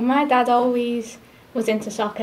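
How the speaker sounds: ordinary delivery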